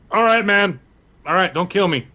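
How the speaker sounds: mildly hammed-up plea for mercy; pleading captive. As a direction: beg the attacker to back off. Captured Ronnie begs at knifepoint.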